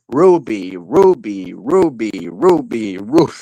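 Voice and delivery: low, swooping repeated calling; Kitchen Man Dan. Acted. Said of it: "what about Kitchen Man Dan?".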